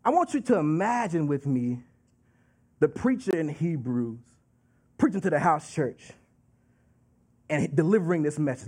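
ordinary delivery

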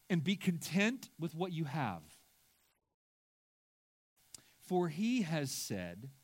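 solemn scripture reading with long pauses. Acted. Reads scripture slowly in sermon.

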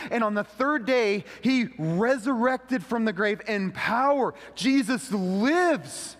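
fervent gospel proclamation; full preaching. Acted. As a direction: proclaim it to the congregation.

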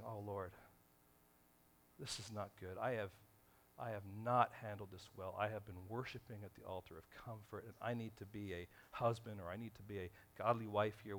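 hushed prayer admitting fault to the Lord; quiet prayer. Retold or imitated. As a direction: pray it low and humble. Imagined repentant prayer.

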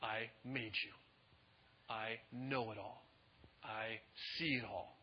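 low, hushed and slow; acted God, solemn and very soft; quiet authority. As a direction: drop to a soft, weighty declaration. Preacher voicing God's solemn rebuke.

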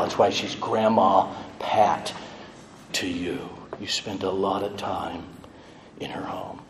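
near-whisper, fading softly; whispered tenderness. Real, not acted. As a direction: drop to a hush. Soft closing words to family.

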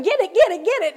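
high, loud frantic cry; half-mock panicked scream at an intruder. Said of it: "standing up and screaming".

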